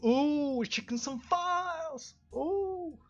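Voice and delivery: high, slow playful sing-song; high mock-cute voice; silly high voice. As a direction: tease it out in a squeaky voice. Silly high voice while reading.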